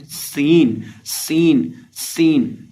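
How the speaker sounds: सीन with a long sharp opening hiss; whistled seen. Shown as a demonstration. Teacher drilling the letter, whistling स in सीन. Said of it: "सीन के अंदर हमने सीटी की आवाज़".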